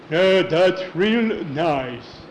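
very slow, drawn-out stage voice; hammed-up granny drawl; fake grandma. Horace in bed hearing of cookies, slow fake-granny voice. Wolf as grandma answers slowly.

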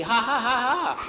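high, spelled-out ha-ha laugh; show-off kid laughing on purpose; child's mock laugh. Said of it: "supposed to be laughing".

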